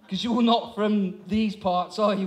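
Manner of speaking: normal talking voice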